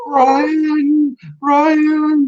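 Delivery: high, drawn-out call of Brian; a female dog calling out longingly for Brian. Retold or imitated. The dog next door calls out to Brian, high and drawn out. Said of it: "she's calling out to Brian".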